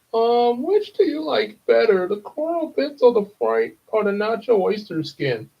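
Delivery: high, chirpy cartoon cashier; high cheerful cartoon sponge voice. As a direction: read it like a cheerful sea sponge. SpongeBob episode read aloud.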